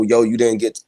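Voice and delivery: ordinary talk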